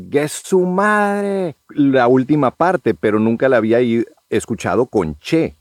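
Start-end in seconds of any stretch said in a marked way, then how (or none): 0.1-1.6 drawn-out, high Mexican "¡Gue su madre!" with a stretched su; slow, lifted Mexican exclamation "¡Gue su madre!"; a Mexican mate daring you, "¡Gue su madre!"; Mexican slang demo. Shown as a demonstration. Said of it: "nosotros cuando abreviamos, igual decimos".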